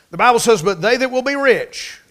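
booming pulpit proclamation; preaching loud. Real, not acted. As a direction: ring it out from the pulpit. Preacher lifts voice for scripture.

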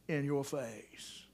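trailing disgust. Acted. Disgust at being spit on.

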